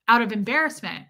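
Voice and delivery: ordinary talk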